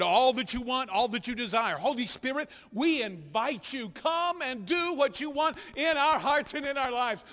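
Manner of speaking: rising, pleading prayer to God; a pastor inviting the Holy Spirit; fervent prayer. Acted. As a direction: lead the room in heartfelt prayer. Leads the group in prayer.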